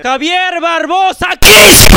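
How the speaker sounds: very slow, high name announcement ending in a roar; euphoric shout. Acted. Streamer announcing a donor with an energy surge, euphoric shout. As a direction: stretch the name and blast the surname. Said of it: "ese subidón de energía".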